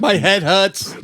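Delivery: confused Bostonian president impression; confused JFK. Co-host playing a confused president in a high Boston whine. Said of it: "sounds like an incredibly confused J F K".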